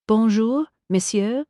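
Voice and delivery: hammed-up French accent, high and bright on bonjour; a fake chef laying the French on thick; florid French accent. A narrator's reading. Chef greeting the detectives, in florid French accent. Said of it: "began in a florid French accent".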